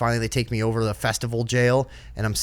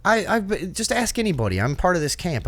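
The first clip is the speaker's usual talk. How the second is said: higher-pitched mock protest; retold arguing; 7 semitones higher than usual. Arguing with festival police over the wristband, retold protest. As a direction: plead your case, retelling an argument. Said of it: "I argued with them about that too".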